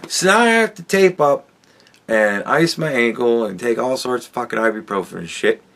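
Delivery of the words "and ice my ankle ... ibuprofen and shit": ordinary talk, nothing marked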